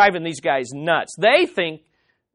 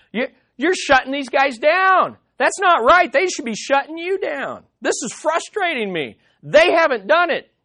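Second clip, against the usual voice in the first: much higher, indignant rant; frustrated zealous friend; 6 semitones higher than usual. Retold or imitated. Zophar fuming at Job's endless talk, high indignant voice. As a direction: play him fed up and self-righteous. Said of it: "Zophar is zealous, he's passionate, and he's frustrated".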